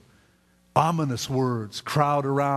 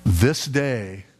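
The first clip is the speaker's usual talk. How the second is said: solemn promise; 4 semitones lower than usual. Retold or imitated. Jesus answers the dying thief.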